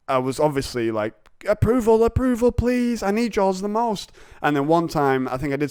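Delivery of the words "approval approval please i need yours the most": high-pitched mock begging; hammed-up, high needy whine; needy pleading. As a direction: plead like a desperate approval-seeker. Voices his craving for boss's approval.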